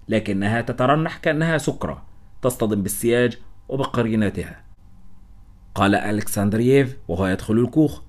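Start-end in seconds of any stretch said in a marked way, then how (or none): none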